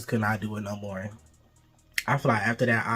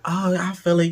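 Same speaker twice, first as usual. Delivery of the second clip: fake moaning; 6 semitones higher, 4 dB louder than usual. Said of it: "fake moaning and all that".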